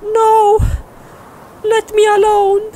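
high, loud put-on woman's cry; distraught girlfriend. Girlfriend Alex refusing Steve, crying out. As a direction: plead like a panicked girlfriend.

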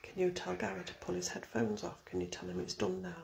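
very soft, hushed instructions; whisper. Real, not acted. Asking someone to end Gary's session, whispering. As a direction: murmur it low and very quietly. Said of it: "I've been really whispering".

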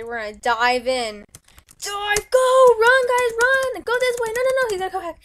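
high, loud frantic yell; panicked shouting. Real, not acted. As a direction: shout them to run. Escape chase, shouting.

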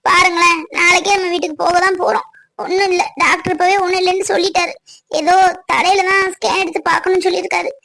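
ordinary talk, nothing marked

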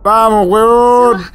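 impatient drawl. Real, not acted. Impatient with cutscene.

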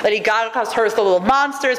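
ordinary talk

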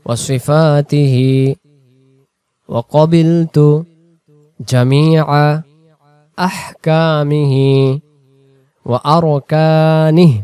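slow, drawn-out Arabic recital with pauses; slow recitation. Shown as a demonstration. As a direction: recite the whole creed phrase by phrase. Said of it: "শুরু থেকে".

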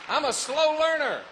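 preacher jokes to congregation, loud; loud punchline. Real, not acted. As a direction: belt the joke at yourself. Self-mocking punchline.

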